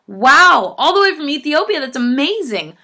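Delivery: high, loud, swooping amazement; amazed delight. Real, not acted. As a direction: gush with big rising wonder at a faraway fan. Amazed reaction to faraway fan.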